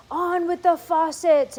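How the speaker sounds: high, slow booming shout; shouted from afar. A narrator's reading. Cloud men calling down from the sky, slow loud shout. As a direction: boom it down from the cloud, drawn out. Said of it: "it shouted".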